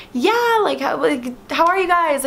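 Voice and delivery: high-pitched, friendly imagined greeting; talkative and nice. Retold or imitated. Comedian as she hoped he would greet fans, talkative and nice. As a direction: imitate a chatty star, pitched well up. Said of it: "very talkative, very nice".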